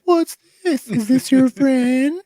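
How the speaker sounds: very high, squeaky put-on voice; joking falsetto. Player mocking amid table laughter, high falsetto. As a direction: squeak it out slowly, stretching the last word.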